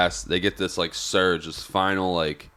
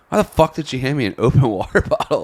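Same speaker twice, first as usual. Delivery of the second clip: laughing disbelief; 6 semitones higher, 3 dB louder than usual. Retold or imitated. Retold exasperation, laughing.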